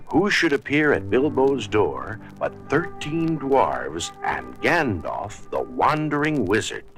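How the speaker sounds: usual speaking voice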